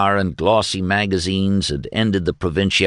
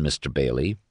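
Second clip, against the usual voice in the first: usual speaking voice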